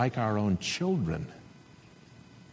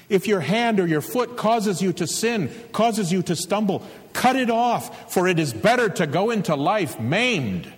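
higher, louder ringing proclamation; proclaiming scripture; 9 semitones higher, 4 dB louder than usual. Retold or imitated. Preacher quoting Jesus to the congregation, ringing declaration. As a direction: declaim it like a prophet.

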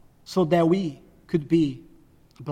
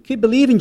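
higher and louder on "Keep believing,"; urgent exhortation; 6 semitones higher, 5 dB louder, much faster than usual. Shown as a demonstration. Jesus urging Jairus to hold on, raised and urgent. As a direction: lift it and press it on him.

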